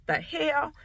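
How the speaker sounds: high, hushed disbelief, imitated; retold startled reaction. ER lady reacts to the voiceless baby, mimicked disbelief. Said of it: "the E R lady was like".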